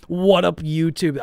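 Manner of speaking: loud, high hyped intro; old YouTube greeting. Shown as a demonstration. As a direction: belt the greeting like a hyped vlogger. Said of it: "I used to say that".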